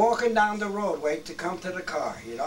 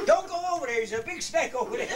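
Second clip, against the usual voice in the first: high mock alarm warning; 10 semitones higher than usual. Pranking friend Johnny with a fake snake warning, mock alarm. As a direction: fake a frightened warning to fool him.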